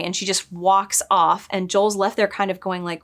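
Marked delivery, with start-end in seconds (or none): none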